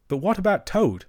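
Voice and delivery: anxious. Acted. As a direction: ask with mild alarm for a friend. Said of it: "asked the mole anxiously".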